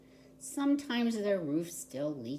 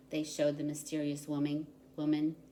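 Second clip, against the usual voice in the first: plain reading voice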